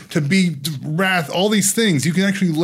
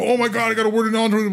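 high, fast, thrilled outburst; mock freaking out with joy; 7 semitones higher, faster than usual. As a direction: rush it out in excitement. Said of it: "freak out and go".

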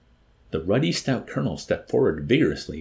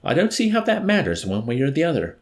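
airy, higher-pitched brush-off; nonchalant; 9 semitones higher, 6 dB louder than usual. A narrator's reading. Officer dismissing the chaplain's plea, nonchalant. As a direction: wave it away as no matter. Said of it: "the officer answered nonchalantly".

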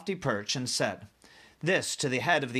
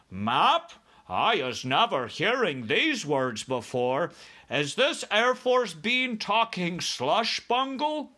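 slow, higher puzzled giant; BFG, baffled; 8 semitones higher, 4 dB louder, slower than usual. Acted. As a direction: question it as a bewildered giant.

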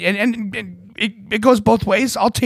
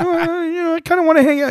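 put-on much higher, small child voice, shy; little kid voice. Dad voicing son who wants to stay with him, high and sweet. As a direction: pitch way up like a bashful boy.

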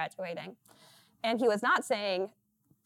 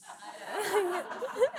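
high laugh; 10 semitones higher than usual. Real, not acted. Laughs at accent question.

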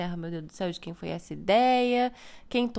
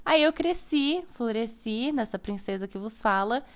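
ordinary delivery

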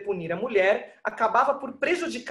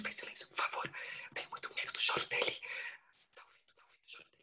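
frantic pleading whisper; whisper, begging for quiet; 13 dB quieter than usual. Acted. Mother hushing, fearing baby's cry.

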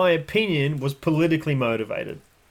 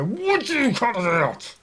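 high, slow exasperated demand; fed-up snap; 8 semitones higher, slower than usual. Retold or imitated. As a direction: finally lose patience with the dog. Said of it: "and then we go".

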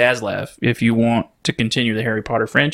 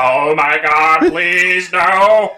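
slow, high, loud fake-American pleading; hammed-up American accent; 6 semitones higher, 5 dB louder, much slower than usual. Villain begging as a fake hostage, mock American accent. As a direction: drag out the plea in an exaggerated American accent. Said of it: "It is the most amazing accent".

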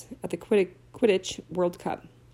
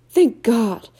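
a cold, shivery sigh of relief, louder; shivering; 3 semitones higher, 8 dB louder than usual. A narrator's reading. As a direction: teeth chattering after the sleet. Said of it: "shivered Ron".

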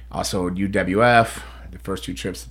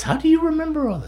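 squeaky high voice. Acted. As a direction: jump way up into falsetto. Asks same question twice.

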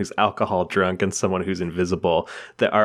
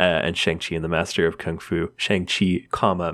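ordinary delivery

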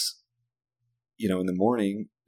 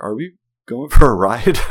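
loud, higher mock-kid demand; hammed-up kid question, much louder; impatient child; 4 semitones higher, 13 dB louder than usual. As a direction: imitate an impatient little boy calling out. Child impatient after repeated bumps.

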